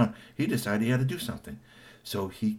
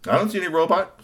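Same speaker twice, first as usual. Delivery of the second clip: character voice; 9 semitones higher, 7 dB louder, faster than usual. Acted. Darius voiced in story.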